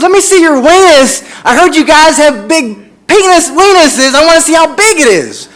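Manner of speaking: loud, high, insistent holler; drunk stranger. Retold or imitated. Drunk stranger demanding a look from across the bar, hollering. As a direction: bellow it like a pushy drunk. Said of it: "had a little bit more, you know, drinks".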